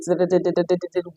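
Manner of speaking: "da, da, da" in a quick level run; straight, toneless tune. Shown as a demonstration. Showing how Nigerians speak straight, "da, da, da" on one level. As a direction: rattle "da, da, da" straight along, no rises or falls. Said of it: "we are going straight".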